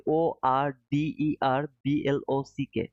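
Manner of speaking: slow, letter by letter O R D E R B L O C K; spelling it out. Shown as a demonstration. Spelling order block for confused viewers, slow and deliberate. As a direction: spell each letter out so they can write it down. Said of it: "sorry for my pronunciation".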